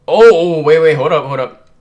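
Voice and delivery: loud, high burst of surprise; startled outburst. Real, not acted. Reader reacting to a sudden manga twist, startled outburst. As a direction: cut in loudly, as if caught off guard.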